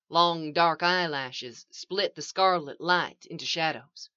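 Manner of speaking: ordinary delivery, nothing marked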